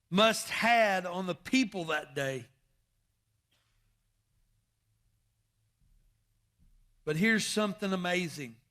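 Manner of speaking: loud, rising preacher's cry; full-force preaching. Acted. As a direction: preach it out to the pews.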